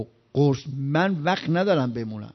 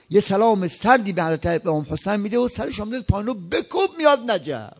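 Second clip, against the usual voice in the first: usual speaking voice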